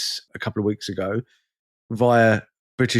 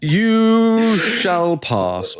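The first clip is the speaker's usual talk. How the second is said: high, very slow, grand booming decree; hammed-up fantasy wizard proclamation; mock Gandalf; 9 semitones higher, much slower than usual. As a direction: boom it out like a wizard at the bridge. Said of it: "the fucking Balrog of the airport".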